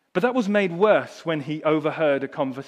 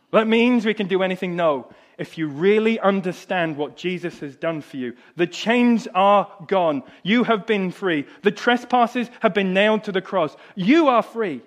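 fervent preaching; 6 semitones higher, 8 dB louder than usual. Acted. Preacher proclaiming freedom to his congregation, fervent and loud. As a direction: drive the point home to the congregation.

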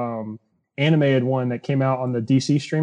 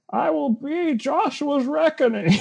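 much higher, slow mock Bane threat; 13 semitones higher than usual. Bane threatening a Smoothie King worker, slow mock villain voice. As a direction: draw out a cartoon villain's threat. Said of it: "the Dark Knight Rises Bane voice".

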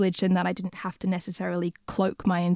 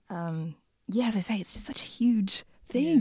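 confiding whisper; whispered aside; 3 semitones higher than usual. Real, not acted. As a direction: drop to a breathy murmur as the thought fades. Reflective trailing aside.

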